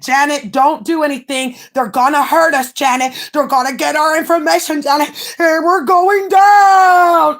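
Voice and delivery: mock panic. Imitating a scared friend begging, mock panic. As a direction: ham up a panicked plea. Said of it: "Then she said".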